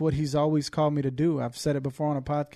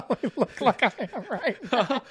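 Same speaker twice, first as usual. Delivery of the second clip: laughing; 9 semitones higher than usual. Real, not acted. Laughing at the red-eyed host.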